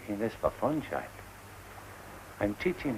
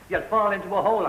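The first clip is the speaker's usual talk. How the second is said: high, raised warning call; worried shouting; 11 semitones higher, 6 dB louder than usual. Acted. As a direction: call out after a straying child. Calling after the boy.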